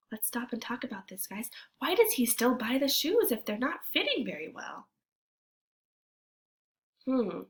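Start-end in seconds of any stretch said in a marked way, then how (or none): 0.1-1.5 unvoiced, hushed and quick; whisper. Real, not acted. Teacher stops reading to talk with kids, whispering. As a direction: lean in and whisper to the class.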